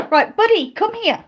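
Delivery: high, loud call to the dog. Real, not acted. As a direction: call the dog over to you. Calling the dog.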